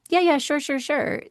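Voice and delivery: high, brushed-off yeah yeah sure; mock dismissive. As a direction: wave it off with a lazy sure sure. Mocking a dismissive reply.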